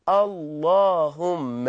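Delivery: اللهم stretched very slowly, low and hushed; slow drawn-out Allahumma. Shown as a demonstration. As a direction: draw out اللهم slowly and deep. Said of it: "فأصبحنا نقول". (نطق اللهم ببطء شديد وبصوت منخفض)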